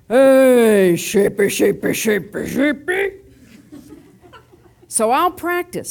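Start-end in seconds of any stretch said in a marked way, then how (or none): 0.1-3.1 big booming deep call, long first word; deep-voiced shepherd. Thief imitates the shepherd's call, deep voice. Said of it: "he has a real deep voice".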